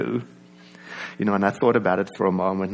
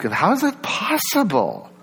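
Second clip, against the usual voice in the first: higher-pitched, incredulous question; acted disbelief; 7 semitones higher than usual. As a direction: ask it as if you can't believe it. Acting out disbelief at oneself.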